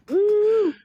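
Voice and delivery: high loud whoop; excited whoop. Real, not acted. Whooping at the punchline of his own story. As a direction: let out a wild woo.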